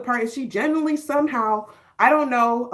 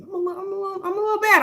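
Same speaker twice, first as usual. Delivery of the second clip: playful high voice; 9 semitones higher, much faster than usual. Acted. Playful pitched-up aside.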